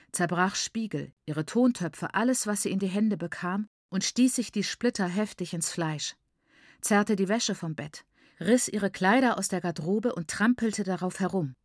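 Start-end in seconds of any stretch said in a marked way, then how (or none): none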